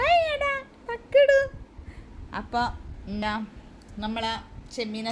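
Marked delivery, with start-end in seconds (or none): 0.0-1.4 high laughing coo, slow. Real, not acted. Laughing at the baby, high slow laughing coo.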